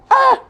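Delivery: very high loud ah; sharp anguished cry. Real, not acted. Host cut off by grief, very high anguished cry. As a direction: cry out in dismay. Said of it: "big blow to us".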